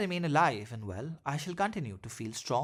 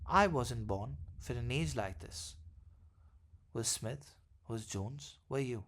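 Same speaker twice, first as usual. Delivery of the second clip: lower, slow, soft poetic reading; poem recital; 4 semitones lower, 5 dB quieter than usual. Acted. Author reciting his own verse before the essay, slow and hushed. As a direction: let the verse settle slowly and quietly.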